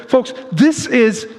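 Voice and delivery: usual speaking voice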